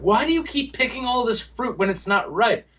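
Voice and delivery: high-pitched mock wife complaining; nagging wife. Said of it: "the wife or whoever is just gonna be like".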